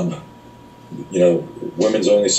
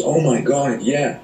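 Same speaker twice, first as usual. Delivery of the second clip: mock realization; 8 semitones higher, slower than usual. Attendant realizing he's right.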